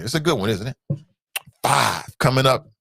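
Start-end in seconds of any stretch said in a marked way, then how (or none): none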